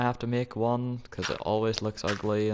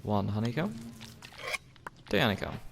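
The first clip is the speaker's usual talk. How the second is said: ordinary delivery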